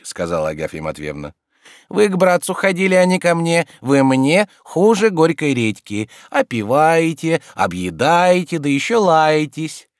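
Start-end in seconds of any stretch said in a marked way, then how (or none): 1.9-9.9 scolding landlady. Acted. Agafya reproaches the freeloading guest, indignant scolding. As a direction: draw out each grievance.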